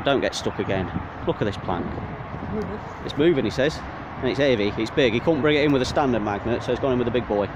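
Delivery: usual speaking voice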